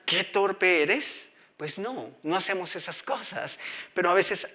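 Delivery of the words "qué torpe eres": drawn-out, mocking pot; slow scornful clay pot; slow mock insult. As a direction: stretch out the insult slowly.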